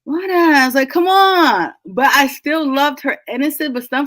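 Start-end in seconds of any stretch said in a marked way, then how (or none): 0.0-1.7 high-pitched, incredulous protest; mock exasperation. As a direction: plead with a clueless character to wise up. Mock exasperation at naive heroine.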